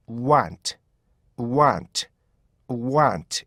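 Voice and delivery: want plain and short; bare want demo; want without the stop. Shown as a demonstration. Teacher contrasts the word without the added stop. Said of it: "앞에 욱이 없으면요".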